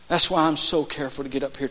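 ordinary talk, nothing marked

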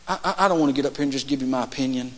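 ordinary talk, nothing marked

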